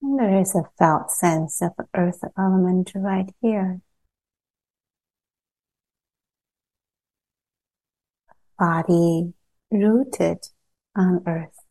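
calm meditation guide with long pauses between phrases; unhurried, evenly pitched guidance, leaving long silences; guided meditation. Acted. Teacher guiding a group to settle into body and earth, guided meditation.

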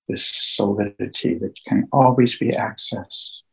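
slow, hushed meditation guide with long pauses; guided meditation. Acted. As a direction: ease listeners into calm, phrase by phrase, leaving long silences.

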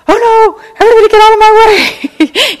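high, loud panicked cry; panicked mom. Retold or imitated. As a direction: shriek like a frantic mother. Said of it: "And being like".

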